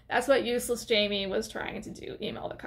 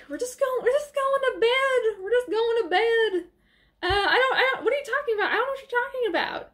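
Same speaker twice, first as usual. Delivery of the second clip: high, babbling mock innocence; flustered bad lying; 12 semitones higher, faster than usual. Jamie fobs off the father at the door, flustered mock innocence. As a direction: stammer excuses in a squeaky put-on panic. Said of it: "He's doing a terrible job of handling it".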